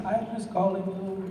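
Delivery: put-on light Haitian accent on the quote; mimicking the Haitian woman's phone greeting in a Haitian accent. Imitating the caller from Haiti in a light Haitian accent. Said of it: "that's my Haitian accent".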